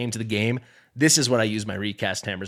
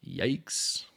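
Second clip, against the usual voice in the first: breathy unvoiced whisper; whispered yikes; 3 semitones lower, 8 dB quieter than usual. Real, not acted. Gamer whispering at a bad gear piece. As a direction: breathe it out under your breath. Reacting to another bad roll.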